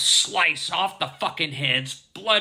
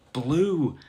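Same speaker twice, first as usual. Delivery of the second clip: ordinary talk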